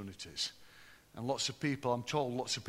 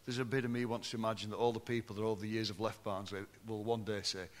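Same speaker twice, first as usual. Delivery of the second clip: normal talking voice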